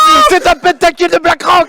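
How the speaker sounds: shouting, high and loud; screamed hype call; esports caster. Acted.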